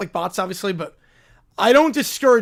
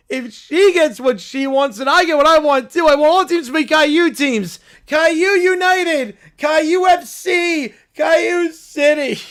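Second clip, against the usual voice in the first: put-on louder, slow chanting kid; demanding Caillou; 6 semitones higher, 8 dB louder than usual. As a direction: chant the team names greedily. Caillou chants team names.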